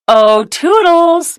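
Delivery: high, slow drawn-out call; Mickey calling his helper, high and drawn out. A narrator's reading. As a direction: sing out the name to summon. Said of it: "Mickey called".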